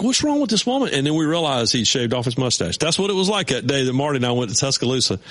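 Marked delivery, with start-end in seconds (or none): 0.0-0.9 baffled. Retold or imitated. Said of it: "We're all like".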